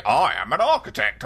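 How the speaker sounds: lightly voiced nerdy professional; mock pompous architect. Host mocking a friend pretending to be an architect, lightly pompous. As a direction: puff it up a little like a self-important expert. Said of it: "Why would you pretend to be an architect?".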